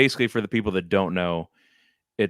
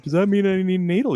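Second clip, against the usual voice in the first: very high, fast, louder question; mock panicked kid; 11 semitones higher, 5 dB louder, faster than usual. Needle-fearing boy asking his dad about stitches, mock panic. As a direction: imitate a frantic child, pitch way up. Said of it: "I'm crying and I'm freaking out".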